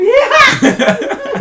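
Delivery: loud high laughter; laughing. Real, not acted. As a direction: burst out laughing. Big laughing fit.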